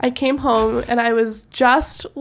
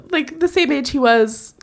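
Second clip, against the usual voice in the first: usual speaking voice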